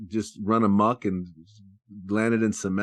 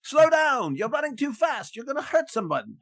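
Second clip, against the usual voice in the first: high-pitched posh chap calling after runaway horses; hammed-up toff pleading with the horses; posh British gent. British rider calling after bolting horses, high and posh.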